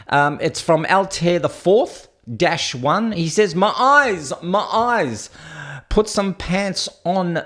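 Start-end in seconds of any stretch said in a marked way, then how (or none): 3.5-7.4 mock horror. Acts out viewer's horrified comment.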